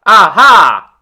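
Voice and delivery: loud, sudden triumphant Aha!; exclaiming. A narrator's reading. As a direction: pounce on it, he has found his prey. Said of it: "exclaimed the tiger".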